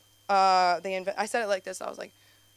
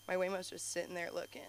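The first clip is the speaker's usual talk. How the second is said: usual speaking voice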